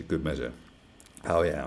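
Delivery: normal talking voice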